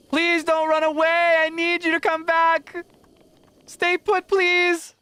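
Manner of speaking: high, loud, slow pleading call; calling after horse. Acted. Rider calling her fleeing horse back, loud and pleading. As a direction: shout after the horse begging it to stay.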